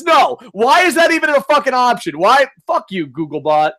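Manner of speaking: high, loud outraged protest; exasperated shouting. Real, not acted. Streamer fed up with YouTube's comment option, exasperated shouting. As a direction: shout your disbelief at a stupid option.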